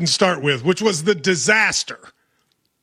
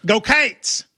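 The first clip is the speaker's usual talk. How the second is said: Kentucky drawl, "Cates" stretched into two syllables; 3 semitones higher than usual. Shown as a demonstration. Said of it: "in Kentucky, we go".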